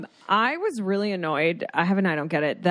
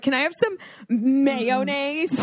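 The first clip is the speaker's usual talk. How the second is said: slow, pitched-up mock request; the ick date; 6 semitones higher, slower than usual. Hot date ordering food and killing the mood, icky. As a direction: draw out every syllable of mayonnaise, high. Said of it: "like, the ick. He's like".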